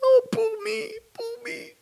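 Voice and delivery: high, whimpering mock self-pity; mock whining. Mocking victims, a high whining "poor me". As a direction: whine it like a sorry victim.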